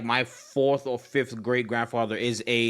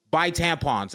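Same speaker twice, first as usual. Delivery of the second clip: ordinary talk, nothing marked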